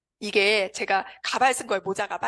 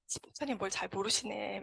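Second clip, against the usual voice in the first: private inner thought; 2 semitones lower, 11 dB quieter than usual. Retold or imitated. Patient privately doubting her cheerful sponsor, very softly. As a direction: say it under the voice, as a silent doubt. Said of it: "속으로는".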